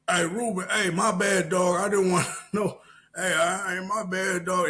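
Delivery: high, loud, hurried backing down; mock apologising. Challenger apologising before the shift ends, pleading.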